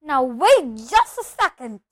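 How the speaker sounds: loud, slow, indignant; thundering. A narrator's reading. Hagrid halts everyone in the hut, thundering. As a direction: bellow it, drawing the words out. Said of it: "Hagrid thundered".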